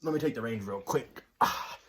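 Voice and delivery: talking through hiccups; hiccuping mid-sentence. Real, not acted. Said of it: "Do you have the hiccups again?".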